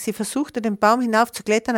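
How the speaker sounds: ordinary delivery, nothing marked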